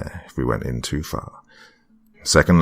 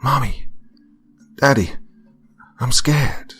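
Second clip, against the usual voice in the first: put-on high, scared child calling out; eerie high child whisper, fading to a breath; small child's voice; 7 semitones higher than usual. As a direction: whisper it like a frightened little girl.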